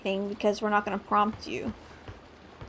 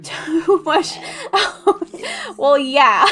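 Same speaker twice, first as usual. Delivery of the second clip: high laughing bursts; 7 semitones higher, 6 dB louder, much slower than usual. Real, not acted. Gamer laughing along with Sammy, laughing. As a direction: laugh it out with a friend.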